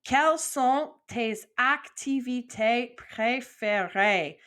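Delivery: slow, deliberate French question; slow model question. Shown as a demonstration. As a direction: lay out the question slowly. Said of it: "break it down".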